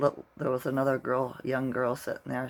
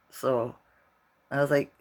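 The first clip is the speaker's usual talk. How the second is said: ordinary delivery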